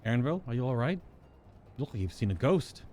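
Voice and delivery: ordinary delivery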